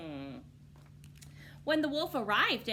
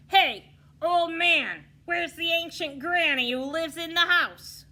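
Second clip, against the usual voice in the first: impatient shout; 11 semitones higher, 14 dB louder than usual. Acted. Wolf shouting at the farmer, impatient. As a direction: call out across a field. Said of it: "he shouted".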